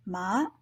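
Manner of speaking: "ma" said low and soft, rising; Mandarin second tone. Shown as a demonstration. Teacher demonstrating second tone "ma", rising. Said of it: "they should sound something kind of like".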